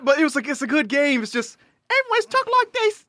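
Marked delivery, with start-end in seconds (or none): 1.9-3.1 high-pitched mock Cockney; hammed-up Cockney, way up high; Cockney accent. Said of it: "Everyone's Cockney".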